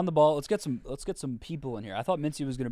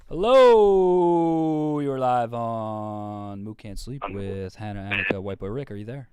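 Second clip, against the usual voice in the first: radio host intro; 9 dB louder, slower than usual. Acted.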